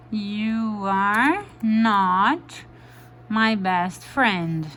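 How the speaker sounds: "not" said slowly and stressed. Shown as a demonstration. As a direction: pausa e ênfase em "not", bem devagar. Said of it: "O not". (ênfase lenta no "not")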